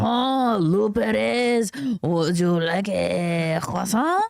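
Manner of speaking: slow, drawn-out mock French accent; hammed-up French accent, lingering on each word. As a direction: suave put-on French waiter. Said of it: "a stereotypical French accent".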